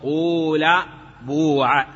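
قول and بوع with a long u; pure-damma reading of قول and بوع. Shown as a demonstration. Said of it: "والضم الخالص".

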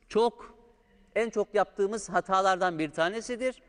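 ordinary talk

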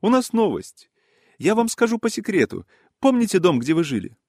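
high, excited character voice sharing news; animated high-pitched gossiping gentleman; eager gossip. Acted. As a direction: confide the news in a high, lively voice. Voices gossiping Yaroslav Ilyich.

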